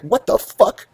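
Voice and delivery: high-pitched mock outrage; exasperated retold outburst at a high pitch; retold pissed-off wife. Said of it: "like, is pissed".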